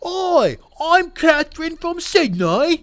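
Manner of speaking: put-on high, loud, drawn-out Australian accent. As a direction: put on a shrieky, over-the-top Australian accent. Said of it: "That's my Australian accent".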